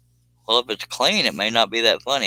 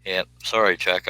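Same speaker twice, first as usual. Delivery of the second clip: ordinary talk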